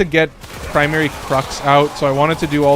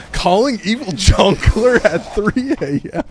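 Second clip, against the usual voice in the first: laughing; 6 semitones higher, slower than usual. Real, not acted. Laughing at own joke.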